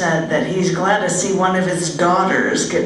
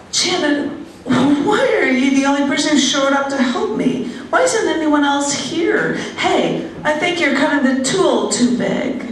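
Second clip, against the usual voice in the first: higher, lightly mocking complaint; imitating the bride friend; 7 semitones higher than usual. Mimicking the frazzled bride friend's complaints, higher. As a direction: whine it a touch, pitched up.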